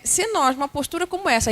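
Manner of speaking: ordinary talk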